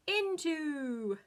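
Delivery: loud heroic shout; superhero voice. Shown as a demonstration. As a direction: blast it out like a superhero. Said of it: "say in a superhero voice".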